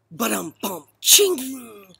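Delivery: punchline "ba-dum-bum, ching"; vocal rimshot. Acted. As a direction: mouth a comedy drum roll and crash.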